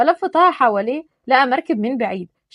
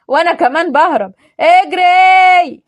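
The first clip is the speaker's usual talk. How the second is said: loud, higher, drawn-out cry to run; yelled run; 6 semitones higher, 9 dB louder, slower than usual. Acted. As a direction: shout it as you bolt. Fleeing, yelling to run.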